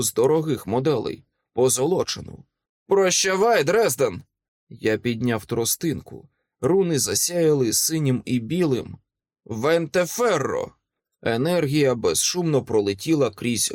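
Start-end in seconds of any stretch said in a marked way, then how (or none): 2.9-4.2 higher-pitched acted farewell; raised voice of an armed foe; villain's taunting farewell. A narrator's reading. 9.4-10.7 spell casting. A narrator's reading. Wizard casts a spell.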